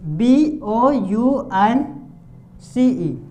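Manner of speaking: बी ओ यू एन सी ई read letter by letter, very slowly; spelling out letters. Shown as a demonstration. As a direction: spell बी ओ यू एन सी ई with long gaps. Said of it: "for example यहाँ पे".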